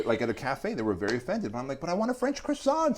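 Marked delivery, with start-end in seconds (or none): 1.8-3.0 high-pitched mock whine for a croissant; mock plea. Customer retelling his plea to French café staff, high mock plea. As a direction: imitate yourself pleading, voice pitched way up. Mimics himself pleading in café.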